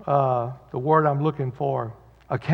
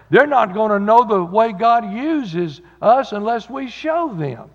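preaching; 4 semitones higher than usual. Acted. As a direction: drive the message home to the congregation. Pastor preaches on Joshua four.

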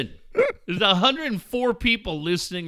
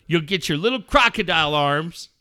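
usual speaking voice

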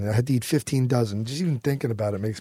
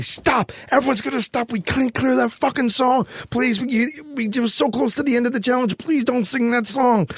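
higher, urgent begging to stop; pleading director; 10 semitones higher than usual. Retold or imitated. Director begs the eaters to stop singing, high and urgent. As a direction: beg everyone to stop, voice pushed up high. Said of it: "my director was going".

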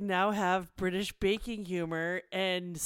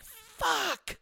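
sudden high yelled curse; angry outburst. Retold or imitated. Friend recalls yelling at the news, sudden curse. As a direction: blurt it out like news rage boiling over. Venting about news rage.

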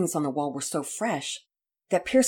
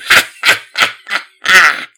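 loud booming villain laugh; pirate captain laughing at the threat; 3 semitones higher, 15 dB louder than usual. Acted. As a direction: laugh him off like a gleeful brute. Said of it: "laughed loudly".

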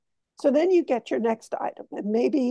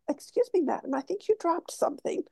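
higher, softer polite gentleman; charming polite voice; 5 semitones higher, 5 dB quieter than usual. Retold or imitated. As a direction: politely hand back what she dropped. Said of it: "a charming way of".

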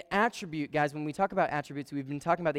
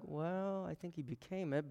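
hesitant disagreement; 2 semitones higher, 10 dB quieter than usual. Retold or imitated. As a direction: gently float an objection under the voice. Retold hesitant, gentle disagreement.

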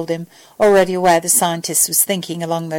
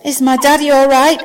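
put-on higher, louder concerned child; 6 semitones higher, 5 dB louder than usual. A narrator's reading. Little girl asks after her father, concerned. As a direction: ask it anxiously, voice lifted.

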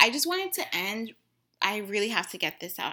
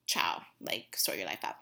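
low whispered brush-off; muttered under the breath, done with them; dismissive whisper; 8 dB quieter than usual. Real, not acted. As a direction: whisper it like a throwaway verdict. Dismissing racist friends.

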